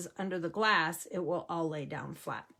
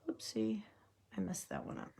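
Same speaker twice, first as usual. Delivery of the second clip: whispering; 10 dB quieter than usual. Real, not acted. Small slip while placing dots.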